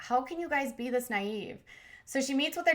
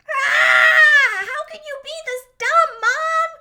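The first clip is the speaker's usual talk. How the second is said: high, loud mocking cry; exasperated shriek; 12 dB louder, slower than usual. Daughter shrieking at her naive mother in a high exasperated cry. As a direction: shriek it at your mother in disbelief. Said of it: "her daughter is like".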